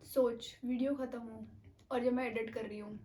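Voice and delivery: normal talking voice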